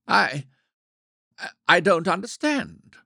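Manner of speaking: flustered and taken aback; stammering. A narrator's reading. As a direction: trip over the first word in surprise. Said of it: "he stammered".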